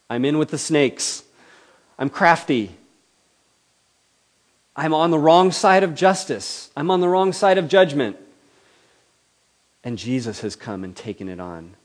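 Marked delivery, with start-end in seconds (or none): 0.1-8.2 rising louder and higher, owning the guilt; a believer crying out their own guilt; fervent confession. Acted. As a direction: build the confession up.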